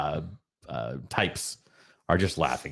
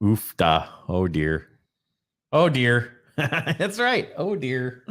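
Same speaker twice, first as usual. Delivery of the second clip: put-on oof da and oh dear in a Minnesota accent, lightly done; a folksy Minnesota exclamation; 4 semitones higher, much slower than usual.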